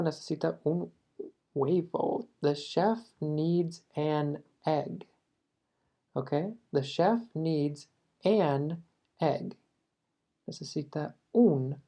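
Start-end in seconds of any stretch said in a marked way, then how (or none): 2.4-5.1 slow, careful English read; slow model sentence. Shown as a demonstration. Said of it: "model sentence". 6.7-9.6 slow, careful English read; slow model sentence. Shown as a demonstration. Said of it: "model sentence".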